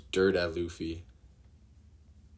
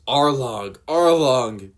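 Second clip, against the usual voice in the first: much louder and higher, screaming the name; anguished scream; 5 semitones higher, 9 dB louder, slower than usual. Acted. Nami stabbing her tattoo, anguished scream. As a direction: scream the name in rage and despair. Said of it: "And yells".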